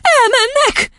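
very high, squealed exclamation; high-pitched distressed cry; child's outcry. A narrator's reading. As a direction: burst out like an upset little girl. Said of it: "kiáltotta végül".

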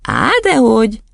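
put-on high child's denial; dismissive schoolboy. Boy waving away the headmistress's suspicion, high and dismissive. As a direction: brush it off like a boy. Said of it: "legyintett Otto".

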